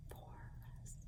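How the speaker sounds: barely audible, unvoiced whisper; whispered counting. Shown as a demonstration. Teacher working out syllables alongside the class, whispered. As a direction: whisper the word to yourself, beat by beat.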